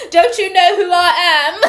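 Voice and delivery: put-on loud, high British-accented outrage; mock British accent. Spurned ex pulling rank, shrill laughing British outrage. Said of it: "Is somebody from the U K?".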